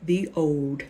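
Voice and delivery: slow, measured, word by word; slow emphatic repetition. Real, not acted. Reader repeating a caution to followers, slow and deliberate. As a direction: hammer it home slowly. I'll say it again.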